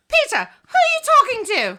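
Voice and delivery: high, loud mother calling out; shrill mom voice. Retold or imitated. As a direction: yell it like a nagging mom. Mom voiced shrieking in retold scene.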